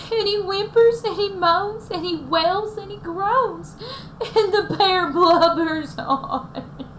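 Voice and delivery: high, slow, mock-weepy narration; dramatic read-aloud. A narrator's reading. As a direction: read it with a sob in it. Narrates bear crying.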